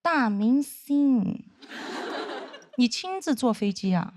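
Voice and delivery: slow, sneering sarcasm; sarcastic drawl. Retold or imitated. The old senior greeting her on the plane, slow sarcastic sneer. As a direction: drag out 大明星 mockingly. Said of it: "非常阴阳说".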